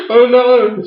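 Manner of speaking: put-on high, loud girlish cry of alarm; hammed-up distraught wail; mock panic. Imitating the girl after the shooting, high mock panic. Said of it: "the girl's like".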